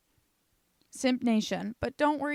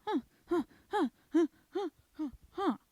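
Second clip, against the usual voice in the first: mock gasps; 8 semitones higher, faster than usual. Gasping sounds after the reveal.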